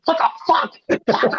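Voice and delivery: mock outburst out of nowhere; imitated sudden snap. Showing how Chicago people snap mid-chat, imitated sudden snap. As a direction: act out losing it mid-greeting. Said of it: "They'll just snap and lose their mind".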